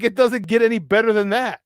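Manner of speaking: ordinary talk, nothing marked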